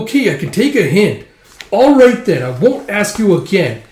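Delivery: high, loud, offended sign-off; indignant little sidekick; huffy elf. Acted. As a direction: give up in a squeaky huff. Elf huffs off.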